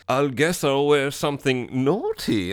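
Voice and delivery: slow, mock-seductive come-on; god-awful flirting. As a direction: imitate a clumsy flirt, drawing it out. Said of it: "that is some god-awful flirting".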